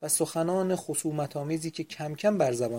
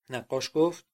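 ordinary delivery, nothing marked

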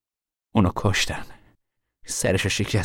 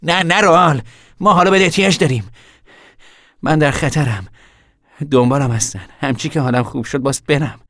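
raised, insistent voice, easing slightly; urgent plea; 7 semitones higher, 6 dB louder than usual. Acted. Hunted man begs his brother to stay, urgently. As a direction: stop him with loud, high urgency.

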